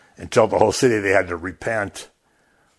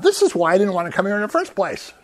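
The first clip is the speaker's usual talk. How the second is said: higher, rushed sulky complaint; sulky Jonah; 8 semitones higher, faster than usual. Acted. Jonah complaining to God why he fled, fast higher sulk. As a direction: blurt out the grudge fast, a prophet sulking. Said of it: "Nice work".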